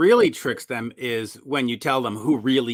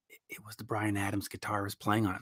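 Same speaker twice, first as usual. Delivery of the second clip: fast hushed whisper; whispered secret; 4 semitones lower, 11 dB quieter, faster than usual. Retold or imitated. Producer revealing a session player, fast whisper. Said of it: "And you go".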